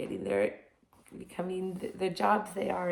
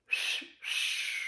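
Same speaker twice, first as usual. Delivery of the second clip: airy breathy whistle attempt; weak whistle; 7 dB quieter than usual. Shown as a demonstration. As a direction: try to whistle, mostly air. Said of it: "My whistle is not very strong".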